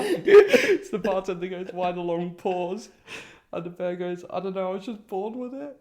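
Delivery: high-pitched, giggly retelling; fighting laughter. Real, not acted. Joke teller retrying the punchline, fighting laughter. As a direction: push the punchline out through laughter.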